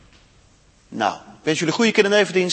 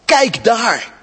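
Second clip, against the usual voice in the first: higher, louder urgent look-there; urgent call; 5 semitones higher, 4 dB louder than usual. Acted. Suze shows Lucy the lion, urgent excited call. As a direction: point it out eagerly.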